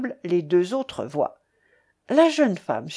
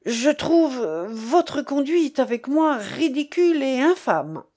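higher, agitated protest; stammering indignation; 5 semitones higher than usual. A narrator's reading. Young man stammering a protest at the man who dragged him in. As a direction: protest as if struggling for the words. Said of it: "balbutiai-je".

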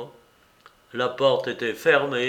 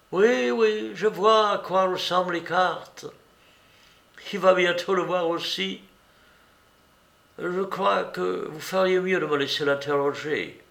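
lightly voiced Mycroft, higher and brisk; Mycroft, impatient; 6 semitones higher than usual. A narrator's reading. Mycroft taking over the interrogation, briskly higher. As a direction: wave it off, then take charge.

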